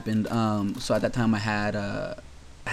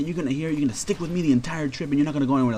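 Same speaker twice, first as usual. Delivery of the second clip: fast, higher nagging rant; rattled-off lecture; 5 semitones higher, faster than usual. Retold or imitated. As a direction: rush through her scolding. Chaperone lecturing him fast.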